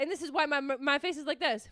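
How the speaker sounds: usual speaking voice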